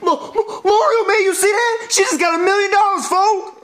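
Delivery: high, stammering excitement; excited amazement. Acted. Telling Mario about the million dollars, excited. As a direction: burst out high-pitched, tripping over the name.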